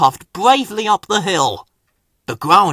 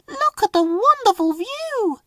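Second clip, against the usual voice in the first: put-on children in chorus. Children speak together.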